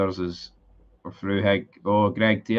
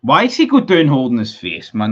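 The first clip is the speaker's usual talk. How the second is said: angry outburst; 4 semitones higher, 8 dB louder than usual. Real, not acted. Fan angry at player diving.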